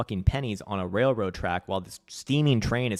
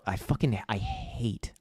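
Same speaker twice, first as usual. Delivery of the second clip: ordinary talk, nothing marked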